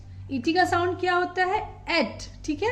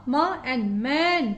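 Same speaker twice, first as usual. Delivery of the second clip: म and man stretched very slowly; slow phonic blending; much slower than usual. Shown as a demonstration. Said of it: "M का phonic sound म".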